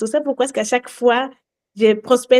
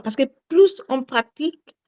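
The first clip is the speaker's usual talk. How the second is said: ordinary talk, nothing marked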